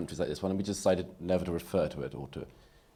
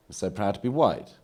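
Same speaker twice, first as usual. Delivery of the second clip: a proud voice, raised and mocking; mock proud declaration; 4 semitones higher, 7 dB louder than usual. Speaker mocking identity pride to a debate audience, mock proud declaration. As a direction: parody a self-congratulating speaker. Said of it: "The unsupportable phase is".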